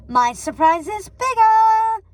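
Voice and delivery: high, loud, slow sing-song yell. A narrator's reading. Gothel to Rapunzel, yelling playfully. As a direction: belt it out like a large puppet. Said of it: "yelled Gothel".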